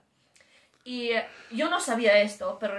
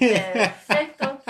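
laughing mock; 8 dB louder, much slower than usual. Laughs mocking her own plan.

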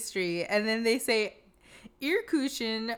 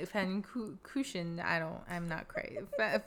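normal talking voice

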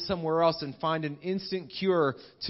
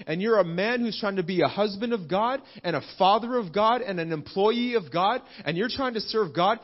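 ordinary talk

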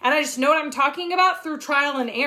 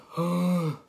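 mock Eeyore; 9 semitones lower than usual. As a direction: whine it like a gloomy donkey. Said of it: "if you are Eeyore".

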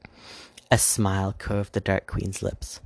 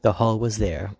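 usual speaking voice

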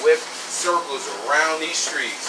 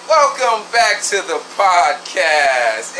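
slow, higher drawn-out host welcome; podcast host intro; 5 semitones higher, 3 dB louder, slower than usual. Shown as a demonstration. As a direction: stretch it out like a show opener. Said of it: "it's time to say".

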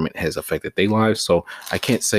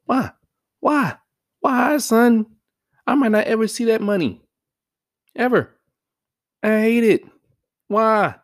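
pitched way up, loud and incredulous; high exasperated rant; 13 semitones higher, 5 dB louder than usual. Real, not acted. Vlogger ranting to viewers about paycheck taxes, high-pitched and exasperated. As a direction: whine it high in disbelief.

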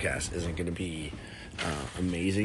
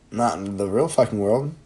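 ordinary talk, nothing marked

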